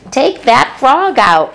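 high, slow, emphatic command; bossy order. A narrator's reading. Fern ordering Avery before he swings, slow and bossy. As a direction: lay down the law to her brother. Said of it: "ordered Fern".

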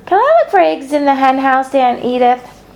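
put-on light, high girlish plea; child's eager question. A narrator's reading. Fern asking Aunt Edith to hunt eggs, high and eager. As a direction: ask the aunt sweetly like a little girl.

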